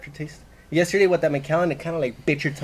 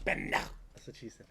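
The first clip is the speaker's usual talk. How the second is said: whispered joke; 6 semitones lower, 19 dB quieter, much slower than usual. Real, not acted. Joke said under breath.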